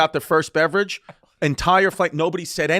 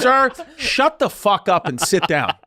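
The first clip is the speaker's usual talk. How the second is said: higher, slow, emphatic mock scolding; retold stern order; 4 semitones higher, 4 dB louder, slower than usual. Flight attendant orders rude passenger down, retold stern order. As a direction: imitate an attendant who has had enough.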